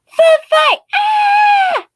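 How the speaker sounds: put-on high shrieking kid yell; kid screaming. Entitled kid screams food fight at the table, high shriek. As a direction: shriek it like a wild toddler.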